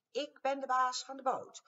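high, loud squeak with baas hit hard; a tiny boss shouting up at giants; squeaky bossy mouse. A narrator's reading. Mouse claiming the boat from the dinos, high and loud. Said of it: "Al had hij een piepstemmetje".

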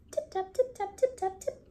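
high, soft squeaky mouse voice; little tip taps squeaked high and soft; tiny mouse tapping. A narrator's reading. Narrator voicing mice tapping under the teacups, high and soft.

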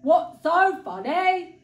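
slow, loud grumpy bear; big bear displeasure; frowning bear. A narrator's reading. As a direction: demand it slowly as a put-out bear. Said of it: "asked the bear with a frown".

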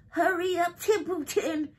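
high, mocking squeal; sneering high-pitched mimicry; imitating Wilbur. A narrator's reading. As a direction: parrot a plea in a mock whiny voice. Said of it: "He began imitating Wilbur's voice".

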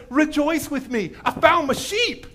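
high, elated celebration; so happy. Retold or imitated. Shepherd calling his friends to celebrate, overjoyed. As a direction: call everyone over in loud, imitated joy. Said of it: "And he's so happy".

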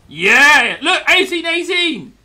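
high, loud victory shout; laughing yell of triumph; triumphant cheer. Real, not acted. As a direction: cheer as the score evens. Team evens the score.

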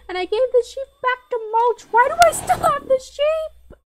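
high, loud outraged disbelief; indignant. Real, not acted. Gamer protesting a glitch, indignant shout. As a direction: protest to the game, baffled.

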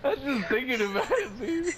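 laughing. Real, not acted. Friends cracking up while gaming.

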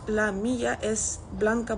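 normal talking voice

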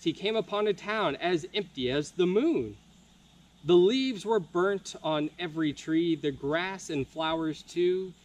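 plain reading voice

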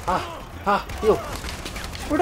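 furious at the attacker; angry shout. Real, not acted. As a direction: yell it at the enemy. Enemy attacks him.

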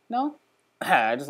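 usual speaking voice

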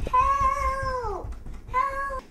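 high, loud cries for help; calling for help. Acted. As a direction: shout for help in panic. Trapped girl calls out.

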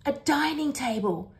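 high, amazed little exclamation; gasped. A narrator's reading. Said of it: "Bingo gasps".

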